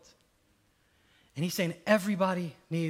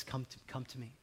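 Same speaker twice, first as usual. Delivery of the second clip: much softer gentle come to me; soft invitation; 6 semitones lower, 14 dB quieter than usual. Acted. Jesus gently interrupts the stuttering believer, softly. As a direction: hush them with a gentle call.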